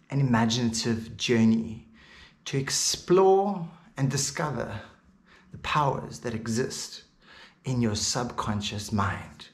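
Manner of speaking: hypnotic guidance. Real, not acted. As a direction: soothe the listener into relaxation, phrase by phrase. Leads a hypnosis session.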